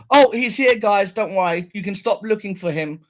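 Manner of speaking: lightly voiced policewoman calling out; a hint of a mocking cop; mock officer calling out. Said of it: "to say".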